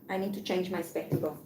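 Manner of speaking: ordinary delivery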